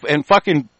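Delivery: ordinary talk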